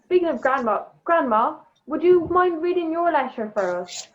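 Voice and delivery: ordinary delivery, nothing marked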